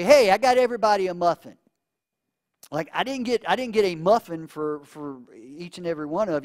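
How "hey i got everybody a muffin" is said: bright, loud and quick; cheerful announcement. Pretend. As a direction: announce the muffin like a grand gift. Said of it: "and I say".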